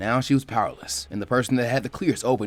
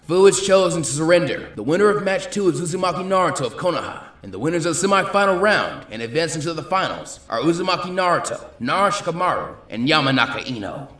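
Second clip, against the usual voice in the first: higher, louder public announcement; 7 semitones higher, 5 dB louder than usual. A narrator's reading. Genma announcing the match results to everyone. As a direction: project to the whole crowd.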